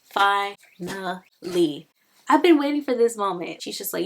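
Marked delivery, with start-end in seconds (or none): 0.0-1.9 very slow, drawn-out word; emphatic relief. Real, not acted. Reader reacts to the long-awaited love confession, emphatic relief. 2.3-3.6 louder, higher, excited outburst; thrilled. Real, not acted. Reader thrilled as the couple profess their love, excited.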